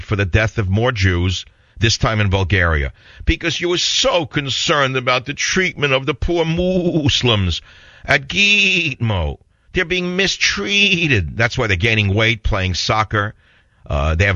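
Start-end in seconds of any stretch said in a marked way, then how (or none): 3.2-11.4 mock pity. Mocking liberal concern for prisoners.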